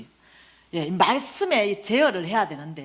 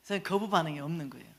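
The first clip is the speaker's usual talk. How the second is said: usual speaking voice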